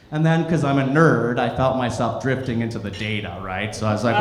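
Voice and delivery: ordinary talk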